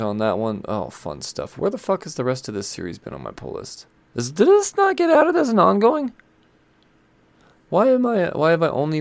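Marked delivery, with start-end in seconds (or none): 4.1-9.0 pitched way up in outrage; how did I miss this; indignant disbelief. Real, not acted. Outraged at missing series.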